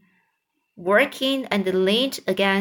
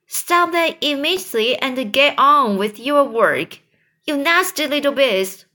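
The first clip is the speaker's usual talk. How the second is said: loud, higher barked command; angry order; 6 semitones higher, 4 dB louder than usual. A narrator's reading. Aunt Sponge ordering James to stop crying, loud and angry. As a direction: order him back to work, furious. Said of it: "Anne Sponge ordered".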